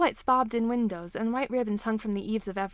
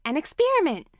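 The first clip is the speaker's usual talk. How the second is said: excited; 6 semitones higher, 6 dB louder than usual. A narrator's reading. Eager interruption finishing his sentence.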